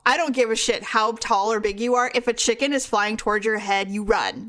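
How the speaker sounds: usual speaking voice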